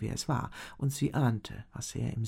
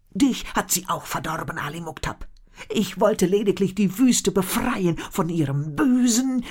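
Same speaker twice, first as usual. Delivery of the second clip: pleading shaman; 7 semitones higher, 9 dB louder than usual. A narrator's reading. Shaman pleads his case.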